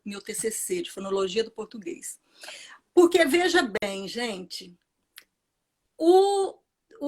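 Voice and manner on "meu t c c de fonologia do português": soft unvoiced whisper; whispered aside. Real, not acted. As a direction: murmur it to yourself as a joke.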